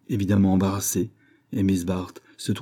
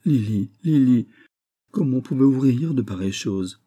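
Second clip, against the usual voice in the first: stammering; 5 semitones higher, 4 dB louder than usual. A narrator's reading. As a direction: stammer it out, troubled. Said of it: "qui ne put que balbutier".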